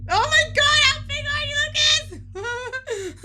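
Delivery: high-pitched excited outburst; amazed squeal. Real, not acted. Player amazed at a friend's huge size, excited squeal.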